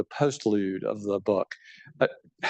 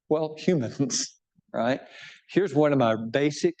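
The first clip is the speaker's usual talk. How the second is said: ordinary delivery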